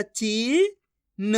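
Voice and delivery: "ची" held long and rising, then a separate "न"; teacher stretching "ची" and "न" apart; syllable-by-syllable pronunciation. Shown as a demonstration. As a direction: sound out "ची", pause, then "न". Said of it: "कैसे पढ़ेंगे एक बार फिर से देखिए".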